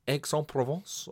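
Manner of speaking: attempted French accent, slightly hammed-up; terrible French accent. Shown as a demonstration. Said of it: "doing a terrible French accent".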